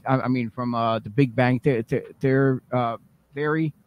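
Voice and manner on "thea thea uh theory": usual speaking voice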